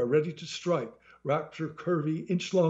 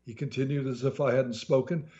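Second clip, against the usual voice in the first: ordinary delivery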